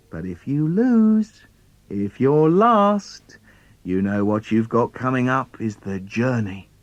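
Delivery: ordinary delivery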